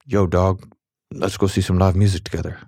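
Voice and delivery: playful put-on street accent, lightly done; tossed off in a vague hip accent; mystery accent. Host voicing a text to a friend, in a light mock accent. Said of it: "I don't know what that accent is".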